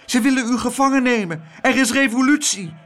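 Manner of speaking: put-on high, panicky boy's voice; high-pitched frantic alarm; alarmed warning. A narrator's reading. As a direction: urgently warn of danger. Abeltje breathlessly warns the president.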